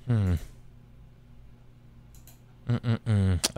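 normal talking voice

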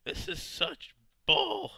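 real outrage; angry. Real, not acted. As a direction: cry out at the unfairness. Hurt by zombie.

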